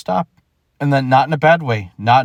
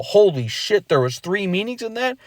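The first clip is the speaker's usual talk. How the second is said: a higher, lightly amazed double take; mock amazement; 8 semitones higher than usual. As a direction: act out a small discovery, light surprise. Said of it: "then you go".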